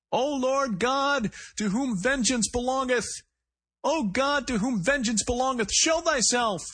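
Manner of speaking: shrill, high, slow crazed preacher's cry; high strained cry. A narrator's reading. Gunman crying scripture from inside the store, high and strained. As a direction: wail the psalm like a man at breaking point. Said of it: "A voice, high-pitched and strained".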